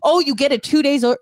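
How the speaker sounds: usual speaking voice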